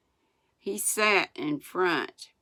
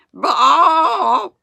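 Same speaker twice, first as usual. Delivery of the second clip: very loud, high calf bawl; cow noise; 12 semitones higher, 13 dB louder than usual. A narrator's reading. Runaway calf bawling as the sled flies, high bellow. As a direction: bellow the written word like a cow. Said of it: "I'm pronouncing it like it says".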